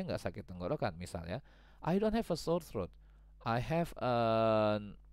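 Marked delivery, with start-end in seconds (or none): 1.8-5.0 acted sick student.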